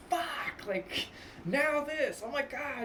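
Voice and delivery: high-pitched exasperated outburst; hammed-up freak-out, voice shooting up. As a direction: act out a driver losing it. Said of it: "you, like, freak out".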